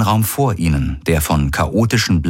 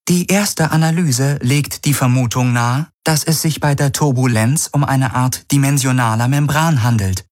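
much higher, even computer voice; ship computer; 7 semitones higher than usual. Acted. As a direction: report it like a calm onboard AI. Ship computer continues analysis.

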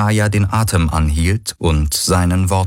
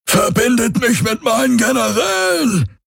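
high robotic yell; bellowing order. Acted. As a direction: roar orders at servants. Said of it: "brüllte er".

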